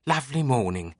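bright, lilting English librarian voice; cheerful British accent. Acted. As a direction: greet them with cheerful British warmth. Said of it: "she said in a cheerful British accent".

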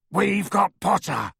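werewolf crowing in triumph; triumphant roar. A narrator's reading. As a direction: gloat like a brute. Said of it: "Greyback roared triumphantly".

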